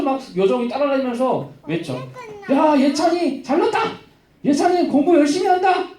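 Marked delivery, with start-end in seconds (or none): 2.5-5.9 high, mock public announcement; calling out. Slave girl trails a pupil shouting his praises, calling out. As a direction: holler it like a town crier. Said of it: "여종이 뒤에서 외쳐".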